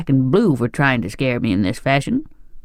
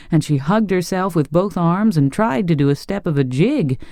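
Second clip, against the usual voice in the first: usual speaking voice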